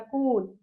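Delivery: कूल with a lengthened "oo"; stretched long vowel. Shown as a demonstration. Teacher repeating the long-vowel example, drawn-out vowel. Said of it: "खिंचती है मात्रा".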